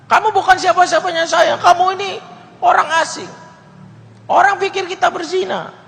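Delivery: high, loud falsetto woman; refusing woman's voice. Acted. Woman rejecting the stranger, loud high falsetto. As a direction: push him away in a shrill girl voice. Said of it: "Wanita ini nggak mau".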